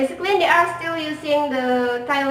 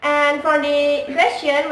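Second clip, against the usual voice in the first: ordinary talk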